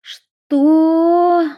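high loud shocked cry; horrified gasp. Acted. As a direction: blurt in terror. Horror in Will's eyes.

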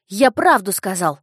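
put-on indignant boy. A narrator's reading. Boy protests.

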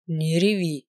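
a low, firm, strict order; stern. A narrator's reading. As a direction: say it sternly, slowly. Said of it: "он ещё раз строго проговорил".

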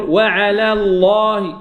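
وعلى الله slowly, light lam in وعلى, heavy lam in الله; correct light lam. Shown as a demonstration. Teacher models the correct reading slowly for students. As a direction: keep وعلى thin, then full الله. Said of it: "أرقق اللام".

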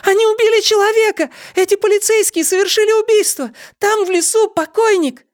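very high, frantic shrieking; panicked girl shrieking accusations; screaming. A narrator's reading. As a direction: scream the alarm at the top of the range. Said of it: "закричала Нола".

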